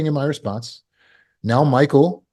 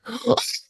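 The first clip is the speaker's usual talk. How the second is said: sharp soft gasp of shock; silent inner gasp; 10 semitones higher, 6 dB quieter than usual. Shown as a demonstration. Father hears daughter's question, quiet shocked gasp. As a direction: gasp quietly, high and breathy. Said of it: "I'm going".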